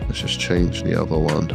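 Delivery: ordinary delivery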